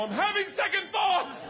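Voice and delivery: high, drawn-out protest; panicked yell. Retold or imitated. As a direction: holler your doubts in comic terror. Panicking at the plane door.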